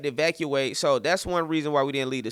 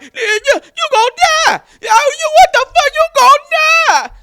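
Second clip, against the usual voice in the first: very high, loud mock shriek; hammed-up frantic yelling; panicked caller; 13 dB louder than usual. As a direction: scream it like a hysterical friend phoning. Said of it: "you also causing panic".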